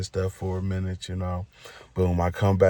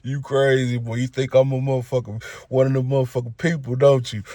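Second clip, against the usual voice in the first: higher, laughing teasing voice; laughing Suge; 4 semitones higher than usual. Retold or imitated. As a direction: chuckle through it like he's teasing you. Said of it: "He started laughing".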